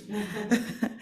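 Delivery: short high giggle; a quick nervous giggle; nervous laugh. Real, not acted. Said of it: "very excited".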